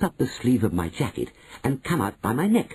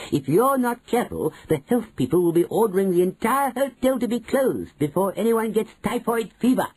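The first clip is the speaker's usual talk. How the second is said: higher, relentless warning; relentless grandmother; 11 semitones higher than usual. A narrator's reading. Grandmother to manager, higher relentless warning. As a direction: pile on the threat, pitched up. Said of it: "went on relentlessly".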